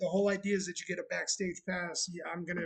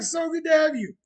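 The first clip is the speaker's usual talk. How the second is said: loud, high friendly call; loud greeting; 7 semitones higher, 8 dB louder than usual. Real, not acted. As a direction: keep shouting the welcome across the bar.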